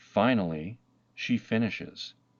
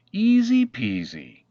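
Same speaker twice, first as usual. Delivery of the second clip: breezy confidence; 5 semitones higher, 7 dB louder than usual. A narrator's reading. Girl confident she can build it.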